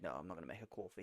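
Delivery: put-on quick, low Boston accent. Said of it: "are you trying to do a Boston accent?".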